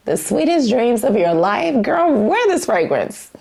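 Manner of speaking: playful disbelief. Real, not acted. Reviewer reacting to the website's lush description, playfully incredulous. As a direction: repeat it as if you can't believe it.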